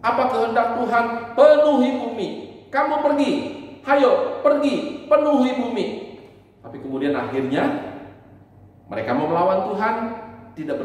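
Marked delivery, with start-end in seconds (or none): 1.4-5.8 loud, high commanding call; commanding shout. Acted. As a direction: command them firmly to go. Voicing God's command to people. (perintah keras dan tinggi)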